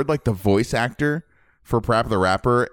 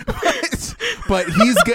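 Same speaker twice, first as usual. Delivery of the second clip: laughing; slower than usual. Real, not acted. High laughing squeal.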